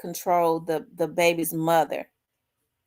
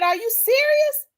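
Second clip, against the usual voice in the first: much higher, stunned disbelief; shocked disbelief; 3 dB louder than usual. Retold or imitated. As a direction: gasp it out, floored by the news. Hears ex had another baby.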